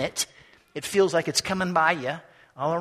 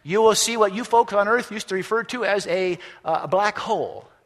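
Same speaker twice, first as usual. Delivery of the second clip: tour guide announcement; 3 semitones higher, 5 dB louder than usual. A narrator's reading. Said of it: "we hear a voice say".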